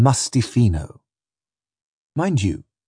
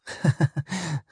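short, soft, mirthless laugh; bitter little laugh; 6 semitones higher, 6 dB quieter than usual. Acted. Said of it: "She gave a bitter little laugh".

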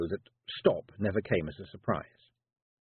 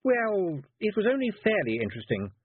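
higher, breezy witch voice; unembarrassed old lady; 11 semitones higher than usual. Acted. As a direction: brush it off without shame. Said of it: "completely unembarrassed".

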